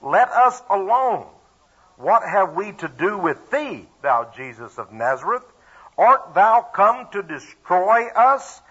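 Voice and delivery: drawn-out, high cry of protest; loud cry. A narrator's reading. Possessed man crying out to Jesus in the synagogue, loud cry. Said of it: "cried out with a loud voice".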